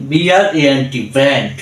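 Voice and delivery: all letters of brand in one connected run; unbroken spell-out, then brand whole. Shown as a demonstration. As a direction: spell straight through to brand without stopping. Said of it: "একভাবে পড়তে পারি".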